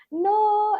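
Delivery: high, drawn-out mock no; lightly acted high-pitched denial; amused dad, imitated. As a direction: stretch it out as a teasing dad. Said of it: "And he just continues laughing".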